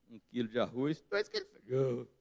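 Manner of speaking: joking demo of an adolescent voice crack; fifteen-year-old's breaking voice. Shown as a demonstration. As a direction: let the pitch suddenly jump like a teenager's. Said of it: "começo com a minha voz de quinze anos de idade".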